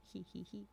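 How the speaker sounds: soft, hushed hee-hee-hee; sly chuckle. A narrator's reading. Narrator tickled that hunters are in a pinch, soft sly chuckle. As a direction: chuckle quietly to yourself.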